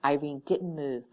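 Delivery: ordinary delivery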